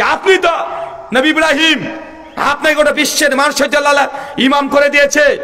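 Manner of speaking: high, ringing appeal; impassioned preaching plea. Acted. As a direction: plead high and sustained, like a preacher calling out. Crowd's plea to Ibrahim, acted.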